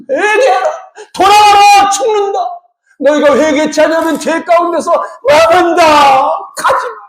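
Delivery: high-pitched, impassioned warning; urgent pleading cry. Retold or imitated. Preacher voicing the Lord calling sinners back, urgently pleading. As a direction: call them back as if they are in danger. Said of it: "첫 번째 하신 말이 뭐예요".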